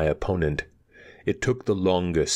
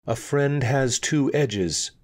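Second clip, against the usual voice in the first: slow remembering; 7 semitones higher, slower than usual. A narrator's reading. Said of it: "she said at last, remembering slowly".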